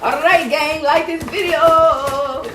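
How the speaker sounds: high, loud, slow vlog sign-off; sing-song ask viewers to like; vlog outro. Real, not acted.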